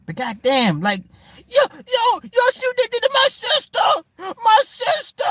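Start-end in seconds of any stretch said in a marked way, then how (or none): 1.5-5.2 shrill high mocking impression of the girl; mock her crying about her sister, way up high; hammed-up wailing falsetto; husky mocking woman voice. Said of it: "And Yandy's like".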